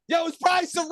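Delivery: excited shout. Real, not acted. Excited shout about a friend.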